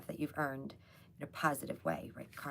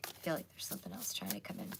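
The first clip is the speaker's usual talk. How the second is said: hushed, quick whisper; 7 semitones lower, 6 dB quieter, faster than usual. Real, not acted. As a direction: whisper it as if sensing something. Tarot reader sensing spirit.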